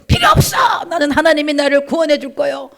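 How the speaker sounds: defiant shout from the water; stubborn refusal. Acted. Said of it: "그리고 안 잡는 거예요".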